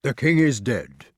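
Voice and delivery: grave, measured announcement; solemn. A narrator's reading. Sir Grummore proclaims the king's death, solemnly. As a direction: intone it with gravity. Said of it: "said Sir Grummore solemnly".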